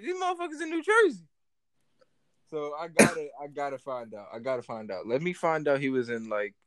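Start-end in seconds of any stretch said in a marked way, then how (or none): 0.0-1.3 squeaky high put-on voice; high mock exasperation. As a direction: jump way up in pitch, rushed. Retold reaction, put-on voice.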